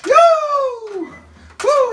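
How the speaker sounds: high, loud wrestler's woo; Ric Flair woo. Pretend. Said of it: "You're gonna be like Ric Flair".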